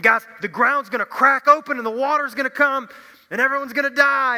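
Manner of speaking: loud, high, insistent warning; urgent warning. Retold or imitated. Noah warns neighbours the ground will crack open, loud and high. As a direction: shout the doom at the scoffers.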